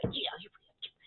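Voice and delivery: breathy confession of a crush; whispered aside about Chase; whispered gush. Real, not acted. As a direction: whisper it like a secret. Gushing about cute man.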